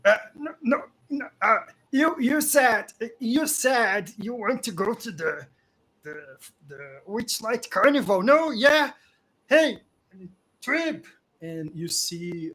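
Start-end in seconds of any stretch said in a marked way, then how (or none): 0.0-11.0 high, loud, stammering goblin protest; flustered goblin. Acted. Goblin protests to the one holding him, flustered high voice.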